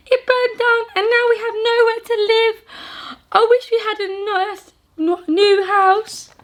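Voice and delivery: high, squeaky little mouse; a small mouse telling the giant her trouble, high and squeaky. Acted. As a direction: speak as a tiny worried mouse.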